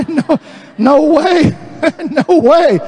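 high, incredulous chuckle through the words; laughing disbelief. Real, not acted. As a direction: laugh it off as absurd. Scoffs laughing at the idea.

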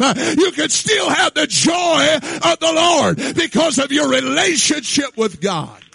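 loud, high, shouted sermon cadence; full-force preaching. Acted. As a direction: preach it out to the whole room. Preacher driving home joy.

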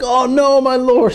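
a retold cry of alarm; crying out in dismay. Man who lost the borrowed axe cries out in alarm. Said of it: "he cries out".

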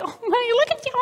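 put-on high cooing baby talk. Adults fooling over a baby, high cooing voice. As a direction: coo it up high like to an infant. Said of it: "We're like".

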